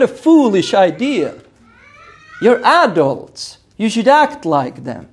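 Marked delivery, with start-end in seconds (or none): none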